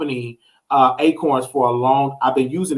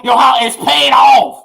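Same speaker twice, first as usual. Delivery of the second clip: triumphant shout; 8 semitones higher, 6 dB louder than usual. Acted. Triumphant announcement.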